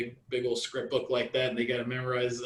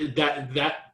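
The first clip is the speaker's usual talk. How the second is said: usual speaking voice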